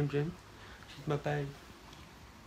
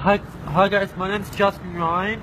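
loud, higher hyped greeting; loud intro; 5 semitones higher, 8 dB louder than usual. Acted. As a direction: shout the channel intro. Shouted channel intro.

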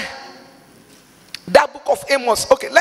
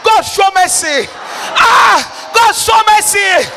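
building cry for mercy; much slower than usual. Real, not acted. Preacher pleading with God for mercy, building from plea to shout.

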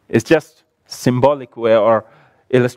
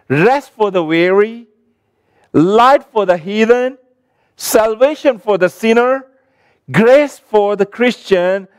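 high, emphatic preacher's declamation in short phrases; rousing recitation; 6 semitones higher than usual. Acted. Recites a rhythmic tribute to the Bible.

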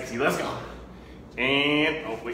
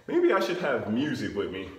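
ordinary talk

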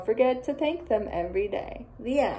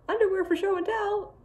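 higher, amused disbelief; 8 semitones higher than usual. Real, not acted. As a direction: ask it as if you can't believe it. Reader reacts to silly sign.

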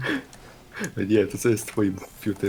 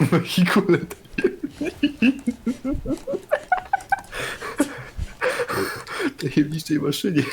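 high, slow laughing repeat. Real, not acted. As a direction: laugh through the words.

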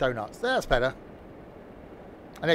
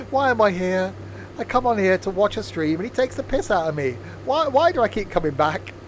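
loud, higher-pitched mock complaint; mock exasperation; 6 semitones higher, 4 dB louder than usual. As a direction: play the fed-up viewer, hammed up. Voicing viewers who regret watching.

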